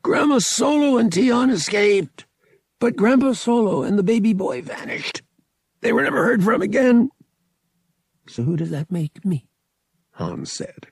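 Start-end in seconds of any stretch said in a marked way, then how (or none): 0.0-7.1 high, loud, strained character voice; pressured cousin Thraken. Acted. Frightened cousin answering Han in story. 8.3-9.5 higher-pitched, puzzled question; baffled. A narrator's reading. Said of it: "completely baffled".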